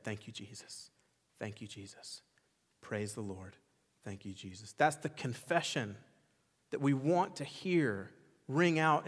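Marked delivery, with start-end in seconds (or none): 0.0-4.7 much softer, low, a murmured thank you; a woman who lost everything softly thanking God; hushed grateful prayer. Retold or imitated. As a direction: breathe it like a quiet prayer of thanks. Lady who lost everything confessing.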